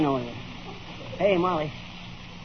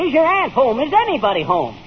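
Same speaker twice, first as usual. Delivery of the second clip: louder, higher exasperated repeat; 6 semitones higher, 8 dB louder than usual. Acted. Salesman repeating himself to a child who won't answer, exasperated. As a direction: ask it again, losing patience.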